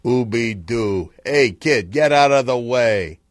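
loud crooner swagger. Acted. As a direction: croon slowly, then brush the kid off loudly.

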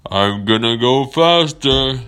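big whale voice. Acted. Whale telling its rider the plan, loud stretched call.

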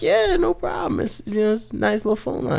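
high, soft, faux-innocent reply; mock innocent. Hacker reassuring the ladies about the phones, high innocent tone. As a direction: play it sweet and harmless.

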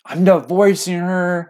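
high, drawn-out mock complaint; slow, whiny-pitched mock outburst; angry dad rant. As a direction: imitate a fed-up husband ranting. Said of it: "I heard something negative".

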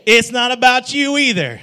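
loud, high it's not about me; loud together. Shown as a demonstration. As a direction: proclaim it to the whole room. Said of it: "Let's try it again".

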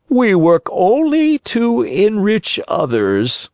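high, slow, sly fox; sly fox warning. Acted. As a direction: warn him slowly, sweetly threatening. Fox feigns virtue.

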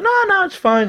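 high retold "I'm fine"; breezy retold protest. Retold dismissal of a taxi order.

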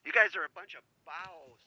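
soft Cockney rehearsal; practicing Cockney accent. Shown as a demonstration. Caller practicing for the hosts, softly attempted Cockney accent. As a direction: try out Cockney quietly, repeating the last word. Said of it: "I'm gonna practice".

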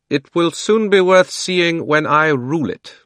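high, loud, slow proud boast; haughty queen. Acted. Queen Jadis boasting to the children, haughty and slow. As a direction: savour the threat of conquest.

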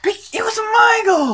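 very high, squealed objection; shouted protest. Real, not acted. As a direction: yell it back, outraged. Shouted protest in gold squabble.

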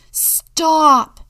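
loud, high, drawn-out shout; high yell at full force. A narrator's reading. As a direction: shout it to halt a noisy crowd. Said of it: "shouted Julia".

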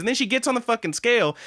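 ordinary talk

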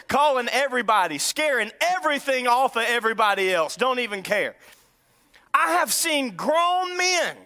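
normal talking voice

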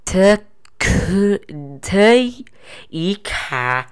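staccato, one syllable at a time. Shown as a demonstration. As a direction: chop it into separate syllables. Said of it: "I'm gonna break up syllable by syllable".

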